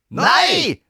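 very high, loud yell; shrieked, outraged refusal; shout. A narrator's reading. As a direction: shout down the request as a whole group of children. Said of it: "ropte Grete, Sara og Robin".

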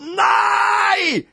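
long, high, loud scream of refusal; horrified scream. A narrator's reading. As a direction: scream it out in protest. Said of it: "skrek rampete Robin".

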